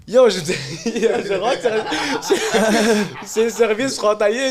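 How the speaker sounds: high, loud laughing narration; laughing excitedly. Real, not acted. Recounting the border arrival to the host, laughing loudly. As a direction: bubble over with laughter.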